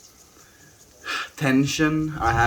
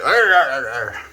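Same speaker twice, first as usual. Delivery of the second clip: repeated loud, higher snarls, easing at the end; poked lion growl; 4 semitones higher, 7 dB louder than usual. Retold or imitated. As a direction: growl like a lion jabbed with a stick. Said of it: "come back with that stick".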